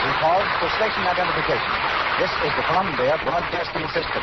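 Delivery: formal radio station break; station announcer. Acted. Announcer giving the station identification in formal broadcast style. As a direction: announce the network, crisp and measured.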